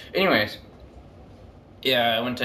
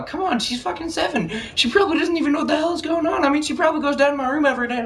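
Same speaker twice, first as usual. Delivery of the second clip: high, fast protest to grandma; exasperated pleading; faster than usual. Retold or imitated. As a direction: argue back in a high-pitched rush. Retold exasperated plea to grandma.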